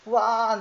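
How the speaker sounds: imitating a little child's high wail; toddler's wail. Father retelling his daughter's fall, mock wail. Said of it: "って言ってよう泣いてました".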